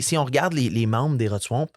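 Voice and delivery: normal talking voice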